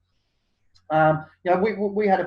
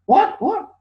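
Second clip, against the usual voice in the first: incredulous what; 14 semitones higher, 6 dB louder than usual. Retold or imitated. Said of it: "and they're like".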